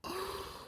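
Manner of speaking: high, soft mock gasp; theatrical intake of breath; shocked gasp. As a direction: suck in air as if scandalised. Reacting to a retold insult.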